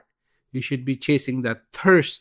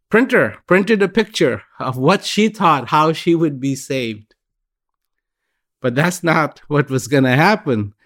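normal talking voice

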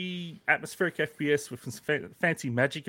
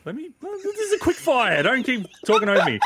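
louder and higher, talked over; annoyed raised voice; 7 semitones higher, 4 dB louder than usual. Real, not acted. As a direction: push back at the interrupter. Cut off by heckling co-host.

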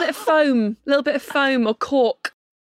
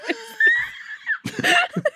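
shrieking laugh. Real, not acted.

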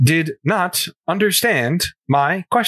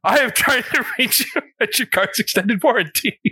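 telemarketer voice; 6 semitones higher than usual. Retold or imitated. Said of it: "That sounds like a telemarketer".

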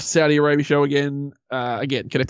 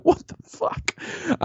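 slow, hushed re-enacted bafflement; mock disbelief; 5 dB quieter, much slower than usual. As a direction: draw it out in a stunned near-whisper. Retelling own first baffled reaction.

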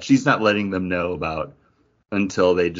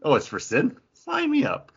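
mock eager sign-up, jumping much higher; hammed-up instant enthusiasm; eager comic characters; 8 semitones higher than usual. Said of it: "every other character in this book is like".

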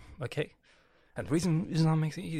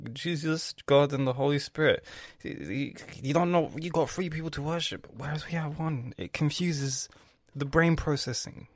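usual speaking voice

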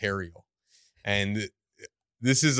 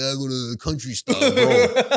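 mock bro; 3 semitones higher, 6 dB louder than usual. Teen boasting about his coffee shop, hammed-up laughing bro. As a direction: play a tough guy bragging with a laugh. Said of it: "It's like".

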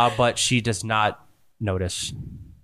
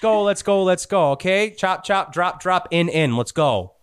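high, hurried bossy villain; a villainess herding her lackey with pitched-up urgency; impatient villain; 10 semitones higher than usual. Acted. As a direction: rush the henchman along, snappy and impatient. GM voices villain hurrying her minion.

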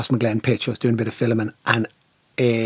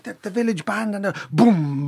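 ordinary talk, nothing marked

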